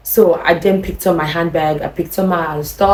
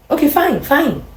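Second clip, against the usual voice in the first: hurt resignation; 9 semitones higher, slower than usual. Retold or imitated. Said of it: "She actually used emotional appeal on me".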